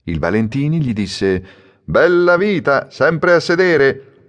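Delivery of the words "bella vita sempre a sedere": higher, louder, slow ribbing; teasing customer. A narrator's reading. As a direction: needle him with a grin. Customer teasing the idle bookseller. (cliente che punzecchia)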